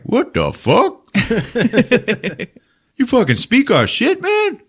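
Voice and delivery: high, surprised alien voice; squeaky astonished creature. Retold or imitated. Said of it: "These Dracs are like".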